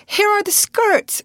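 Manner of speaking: very high, loud, stretched-out call; excited cry. A narrator's reading. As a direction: sing out that help has arrived. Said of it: "cried Alice".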